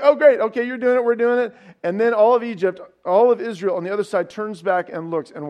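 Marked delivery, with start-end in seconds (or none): 0.0-1.6 higher, louder, hammed-up eager agreement; mock eager. Israelites cheerfully agree to walk in, mock eager. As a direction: play the eager crowd cheering.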